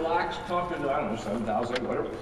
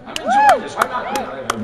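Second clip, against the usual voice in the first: much higher and louder, bursting with delight; gleeful burst; 8 dB louder than usual. Real, not acted. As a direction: blurt it out with pure joy. Gleefully insisting he loves it.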